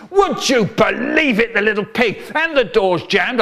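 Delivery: lightly acted roaring dad. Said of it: "and he's roaring".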